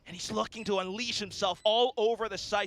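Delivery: rising hype play-by-play call; esports caster. Real, not acted. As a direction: build to the clutch, caster style. Play-by-play of round end.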